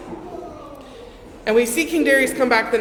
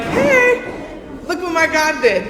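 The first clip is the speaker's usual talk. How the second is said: high, bright burst of delight, laughing; Daniel bursting out proud and overjoyed; cheerful triumph; 6 semitones higher, slower than usual. Retold or imitated. Daniel climbing out of the den to the king, high joyful laughing.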